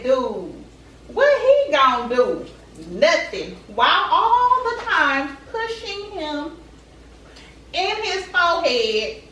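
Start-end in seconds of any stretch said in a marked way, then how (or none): none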